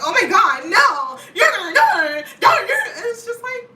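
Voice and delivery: hammed-up horrified protest; shocked. People shocked she lives in LA, loud high panicked protest.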